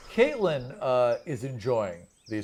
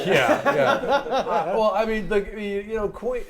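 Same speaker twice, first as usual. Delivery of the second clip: high, loud laughing yeahs; 8 semitones higher, 5 dB louder, slower than usual. Real, not acted. Game master laughing at a player's joke, laughing. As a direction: laugh through the words.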